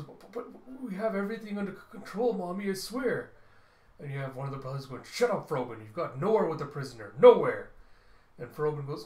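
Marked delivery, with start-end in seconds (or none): 0.0-3.3 higher, fast, louder stammering whine; whiny panicked plea. Acted. Son begging his mother not to be angry, whiny and panicked. 5.0-7.7 loud, fast scolding that peaks on the last Nowhere; angry shout. Acted. Brother yelling at Frobin over the prisoner, loud and furious.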